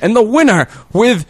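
high, loud, slow announcer voice; hammed-up winner announcement; mock announcer. [name] announcing the hunt winner, hammed-up and loud.

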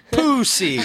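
high, mangled mock Israeli accent. Mocking how they ruin beautiful words, Israeli accent. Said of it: "They take the most beautiful words and ruin 'em".

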